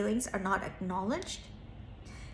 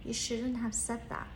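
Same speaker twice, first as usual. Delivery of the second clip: inner voice; 8 dB quieter than usual. Retold or imitated. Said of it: "kept saying".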